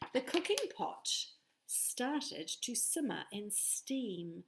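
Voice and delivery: ordinary delivery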